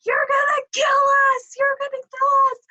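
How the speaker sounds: high, strained imitating husband yelling; mock panicked yell. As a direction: squeal it like a scared husband on a tipping jet ski. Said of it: "he's yelling".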